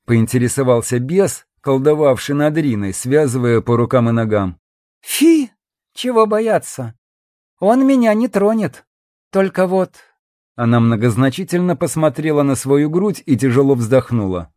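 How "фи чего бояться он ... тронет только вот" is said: high-pitched feminine pooh-poohing; dismissive scoff. A narrator's reading. Woman asked if she fears the general, airy scoff. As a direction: brush it off airily in a high voice.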